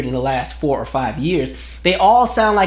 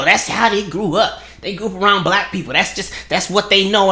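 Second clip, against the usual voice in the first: lightly put-on apologist voice, a little higher; mock defender; 4 semitones higher than usual. Mimicking the argument that they grew up that way, light mocking voice. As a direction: parody someone making excuses, lightly. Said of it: "One argument is".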